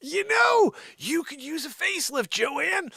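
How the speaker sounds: very high-pitched comic exasperation; mock rant. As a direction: pitch it way up to heckle her. Mock ranting at Joanne.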